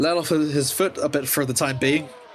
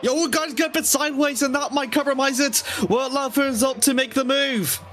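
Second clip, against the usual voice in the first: excited commentary; 10 semitones higher than usual. Real, not acted. Car sideways live.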